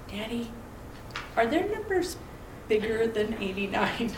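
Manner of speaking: put-on soft, hushed little-girl question; small child's question. A tiny daughter softly asks her daddy about numbers.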